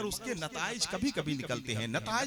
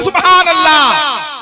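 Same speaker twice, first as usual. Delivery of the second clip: drawn-out, much louder सुभान अल्लाह with weight on सुभान; loud slow Subhan Allah; 8 semitones higher, 23 dB louder, slower than usual. Shown as a demonstration. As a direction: show the crowd how to call सुभान अल्लाह. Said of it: "हाथों को उठाकर बोल दे". (ज़ोर से खींचकर सुभान अल्लाह)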